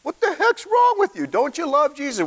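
hammed-up exasperated high voice; frustrated outburst. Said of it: "But he was like".

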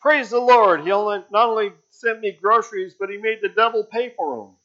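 high, loud, fast jubilant shout; lady jumping for joy. Acted. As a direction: clap and cry out in triumph.

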